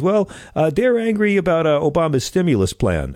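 high-pitched dad impression; imitating dad. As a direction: play an agitated dad explaining himself, pitched well up. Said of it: "He goes".

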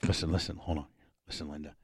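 soft, hushed taunting; hushed teasing. Real, not acted. Husband quietly teasing his frightened wife on the plane, hushed. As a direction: lean in and murmur to spook her.

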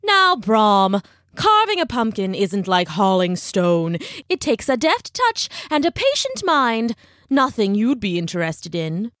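pompous, condescending teacher with swooping pitch; talking down. Acted. Ichabod lectures Brahm on pumpkin carving, condescending. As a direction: lecture him as if he were slow. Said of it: "started talking down to him".

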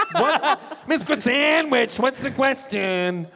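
high, slow schoolkid taunt; teasing pupil. Retold or imitated. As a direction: needle the teacher with her nickname, half laughing. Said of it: "we used to call her Miss Croissantwich".

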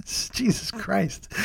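soft laugh, slow; breathy laughing aside. Real, not acted. Laughing at own slip.

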